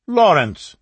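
loud, high, sharp call of the name; objecting cry. A narrator's reading. Mother cuts off her son's rude reply, loud. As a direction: stop him right there.

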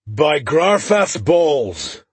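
gruff dwarf roaring, slow. Acted. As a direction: bellow it out, gruff. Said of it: "the overly gruff voice from the other side of the tavern roared".

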